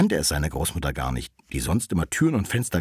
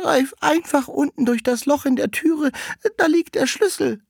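high, loud put-on granny voice; old grandmother calling; 5 dB louder than usual. Grandmother tells the visitor where the key is, high calling voice. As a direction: call it through the door as a high-voiced granny. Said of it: "rief die Alte".

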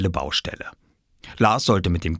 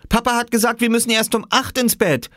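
indignant; 3 dB louder than usual. A narrator's reading. Oskar keeps protesting.

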